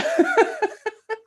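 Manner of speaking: high burst of laughter. Real, not acted. Remembering her poker-playing grandma, high laugh.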